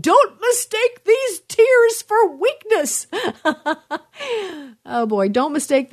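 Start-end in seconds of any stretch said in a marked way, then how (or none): 0.0-3.1 high, drawn-out crying yell; hammed-up wail through tears, much higher and slower; mock tearful yell. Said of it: "I'm crying. And I said". 3.1-4.0 high burst of laughter; high-pitched, self-amused laugh; real laugh. Real, not acted. Laughs at her own re-enactment.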